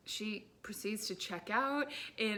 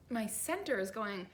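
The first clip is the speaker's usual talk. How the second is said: normal talking voice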